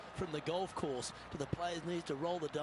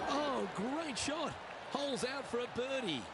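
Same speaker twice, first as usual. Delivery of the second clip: excited; 7 semitones higher than usual. Real, not acted. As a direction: burst out in delight at the shot. Excited reaction to hole-out.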